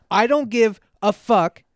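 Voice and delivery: put-on loud, insistent sleep-talking girlfriend; dead-serious rant. Girlfriend half-asleep, insisting on car insurance, loud and stern. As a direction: hammer every demand flat out. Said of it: "looked me dead in the face".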